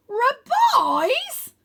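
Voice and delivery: very high, loud bellowed question; boy bellows the command at the dragon. Acted. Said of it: "Wynne bellows".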